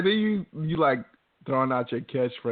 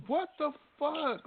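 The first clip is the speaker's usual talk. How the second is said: disbelief; 12 semitones higher, 7 dB quieter, slower than usual. Real, not acted. Echoing friend in disbelief.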